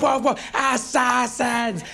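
high, drawn-out alarm cries; fanatical barking dog. Acted. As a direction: howl out the words as a zealous dog. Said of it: "They just go".

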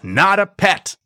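high-pitched objection, a mild yell; contained yell of protest; indignant yell. A narrator's reading. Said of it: "I yelled".

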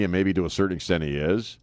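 ordinary talk, nothing marked

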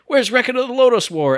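high, loud excited ask; calling out to the store eagerly; eager question. Retold or imitated. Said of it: "And I went to Suncoast that very weekend and I went".

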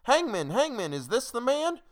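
high, loud crowd's appeal; high-pitched questioning cry. A narrator's reading. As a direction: call out urgently, pitched right up. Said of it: "and we cried again".

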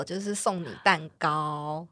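ordinary delivery

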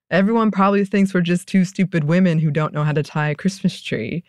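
normal talking voice

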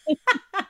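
high-pitched laugh; laughter. Real, not acted. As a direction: burst out laughing at yourself. Laughs at her own complaint.

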